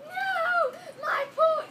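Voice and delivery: high, loud wail over the pool; mock anguished shout. Kid pretending to lose his pool, loud wail. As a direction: scream it like the pool is ruined. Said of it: "I'd be like".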